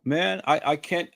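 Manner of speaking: usual speaking voice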